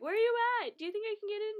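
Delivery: high, loud mock phone chatter; imitating a friend shouting into the phone; mock eager calling. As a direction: ham up a friend calling around.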